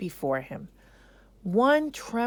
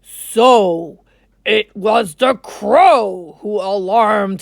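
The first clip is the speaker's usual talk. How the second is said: louder, booming lion king; king's voice; 13 dB louder than usual. Acted. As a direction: announce it like a ruler. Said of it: "The king said to the council".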